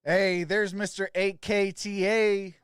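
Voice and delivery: usual speaking voice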